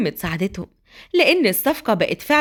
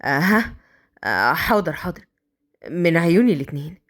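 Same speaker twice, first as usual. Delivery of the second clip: voz de hombre, grave y ausente; Mazen distraído; 6 semitones lower than usual. Acted. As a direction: asentir con la cabeza en otra parte. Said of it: "مازن بتوهان".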